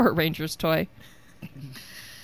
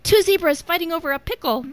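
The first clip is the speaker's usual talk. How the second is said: put-on higher, bright child voice; kid voice; 10 semitones higher, 4 dB louder than usual. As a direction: answer like a little kid. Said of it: "I'm trying to do my kid voice".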